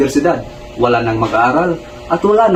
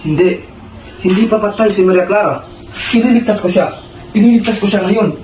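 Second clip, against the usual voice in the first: desperate denial; 5 semitones higher than usual. Acted. Refuses news of her death.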